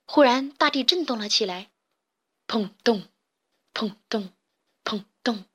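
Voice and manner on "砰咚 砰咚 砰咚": spoken booming footfalls, evenly spaced; imitated rumbling thuds. A narrator's reading. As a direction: voice heavy thuds from underground. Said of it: "地底传来了一阵阵巨响".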